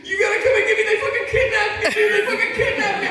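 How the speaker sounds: high, loud, laughing panic voice; panicked friend on phone. Retold or imitated. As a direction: squeal like a drunk buddy begging for rescue. Rita's panicked phone call voiced.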